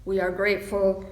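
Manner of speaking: ordinary talk, nothing marked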